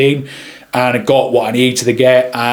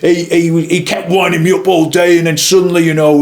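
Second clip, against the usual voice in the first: higher put-on voice of a colleague; other photographer's voice; 5 semitones higher than usual. Photographer telling of a guest who wound him up, put-on voice. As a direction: imitate a fellow photographer, pitched up. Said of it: "he talked with this kind of".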